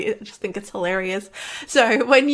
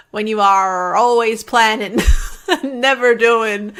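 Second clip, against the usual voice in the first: put-on a lightly done, slow, louder pirate accent with a laugh in it; 6 dB louder, slower than usual. Vlogger voicing Captain Checklist for her viewers, in a hint of a pirate accent. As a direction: growl it out like a cartoon pirate captain, but only lightly. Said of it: "that's my best pirate accent".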